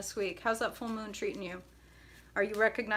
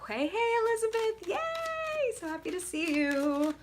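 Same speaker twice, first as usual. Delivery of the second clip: much higher sing-song hello; excited greeting; 12 semitones higher than usual. Real, not acted. Reader welcomes a viewer to the live, much higher excited hello. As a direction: squeal a delighted welcome.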